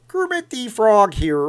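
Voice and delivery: high, loud, drawn-out frog puppet voice; amateur Muppet frog imitation; Kermit the Frog. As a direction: announce yourself as a puppet frog. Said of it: "first time I ever tried to do that voice".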